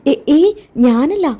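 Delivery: high, slow, stumbling denial; hurriedly disown it, stalling on the first sounds; flustered denial. Acted. Son denying he told grandmother, flustered.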